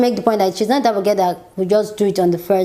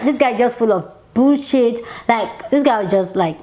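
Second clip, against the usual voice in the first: ordinary delivery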